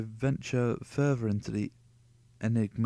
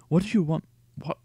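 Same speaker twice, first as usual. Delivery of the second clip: angry demand; 6 semitones higher, 7 dB louder, faster than usual. Acted. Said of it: "He spits at me".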